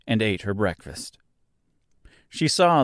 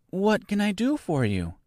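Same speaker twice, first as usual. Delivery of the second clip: put-on high, gentle girl's voice; soft inquiry; 10 semitones higher than usual. A narrator's reading. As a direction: ask softly and kindly, pitched high. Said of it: "she inquired softly".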